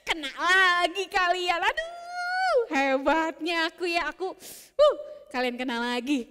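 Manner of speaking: high cackling laugh then smug, slow teasing; shepherd boy laughing at fooled villagers; gloating laugh. Acted.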